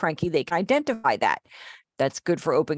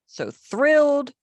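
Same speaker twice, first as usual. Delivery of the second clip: thrilled; 8 semitones higher, 4 dB louder, slower than usual. Shown as a demonstration. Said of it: "Notice the inflection takes place on the face".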